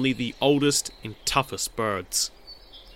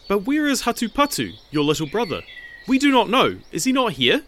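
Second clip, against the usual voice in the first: higher, anxious parental questions; worried parent; 8 semitones higher than usual. A narrator's reading. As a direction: ask anxiously for the missing boy. Worried parents asking for son.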